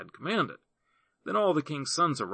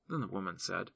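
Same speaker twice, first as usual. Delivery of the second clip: ordinary delivery, nothing marked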